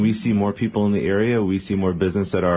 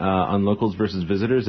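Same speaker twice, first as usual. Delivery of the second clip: usual speaking voice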